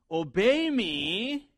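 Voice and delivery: "obey me" stretched out slowly; stress on obey me. Shown as a demonstration. Preacher showing what parents mean, slow emphatic "obey me". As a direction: draw out "obey me" as the point that matters. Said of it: "The emphasis is in the".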